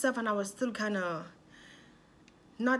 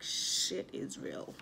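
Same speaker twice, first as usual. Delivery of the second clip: whispered aside; 6 dB quieter, much slower than usual. Retold or imitated. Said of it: "I was like".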